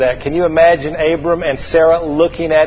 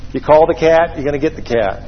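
ordinary talk, nothing marked

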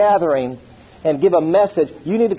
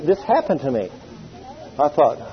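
normal talking voice

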